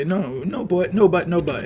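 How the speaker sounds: whiny high mock objection; mocking "no, but". Mocking people who say no but.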